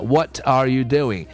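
"What are you doing" slow and full; word by word. Shown as a demonstration. Teacher shows the safe reading, slow full words. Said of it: "单个字读当然没有问题".

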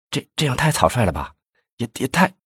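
a touch of alarm, stuttering; startled stammer. Acted. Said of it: "魏显波一惊". (受惊结巴，声音偏高偏轻)

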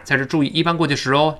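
ordinary talk, nothing marked

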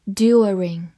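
British-style "During" with a y-glide; British accent. Shown as a demonstration. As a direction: say "During" the British way, DYOO-ring. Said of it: "或者美式发音".

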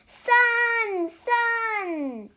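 high, drawn-out call. A narrator's reading. Pig calls to the sun in the well, calling out high. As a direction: call down a well, stretching each word.

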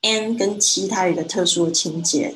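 normal talking voice

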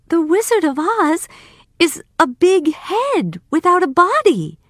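put-on high-pitched objecting girl; girl character. A narrator's reading. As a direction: protest in a high girl's voice. Dorothy objects.

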